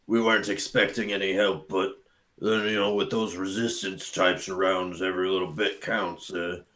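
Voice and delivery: rough, throaty monster voice; gruff bugbear voice. Acted. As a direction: growl it like a bugbear. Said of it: "that was rough on my throat".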